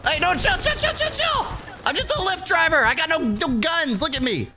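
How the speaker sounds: high, pleading panic, chill chill chill; panicked pleading. Acted. Lyft driver pleading with gunmen not to shoot him, high-pitched panic. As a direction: squeak out a plea not to be shot.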